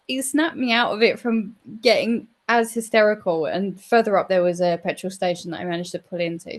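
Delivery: usual speaking voice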